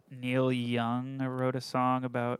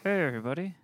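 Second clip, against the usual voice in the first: higher put-on puppet greeting; 5 semitones higher, much faster than usual. Puppet says hello at work, high put-on voice. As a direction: talk for the puppet in a raised voice. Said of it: "You would just do this all the time".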